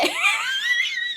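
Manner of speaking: high squeal of laughter; can't keep a straight face; cracking up. Real, not acted. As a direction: break into a laugh mid-sentence. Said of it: "I can't say that with straight face".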